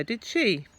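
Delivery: ordinary delivery, nothing marked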